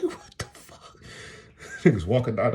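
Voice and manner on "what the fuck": breathy shocked whisper; whispered what the fuck in shock; stunned whisper. Retold or imitated. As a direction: mutter it under your breath in disbelief. Shocked reaction to kids smoking.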